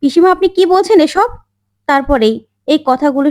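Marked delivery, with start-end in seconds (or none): 0.0-1.3 higher, indignant outburst; furious protest. A narrator's reading. Rajib confronts his aunt over her insults, furious. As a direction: snap back at the elder in outrage. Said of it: "তখন রাজীববাবু প্রচন্ড রেগে যায় আর বলে".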